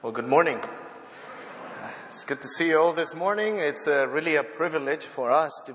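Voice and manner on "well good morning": normal talking voice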